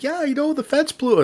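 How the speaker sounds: high, friendly Canadian neighbor voice; Canadian guy impression. Neighbor greeting them at the fence, high cheery Canadian accent. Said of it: "good, good old Canadian guy".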